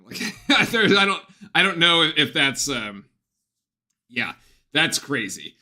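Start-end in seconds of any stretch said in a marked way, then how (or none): 0.5-2.7 laughing. Real, not acted. Laughing at opponent's shooting.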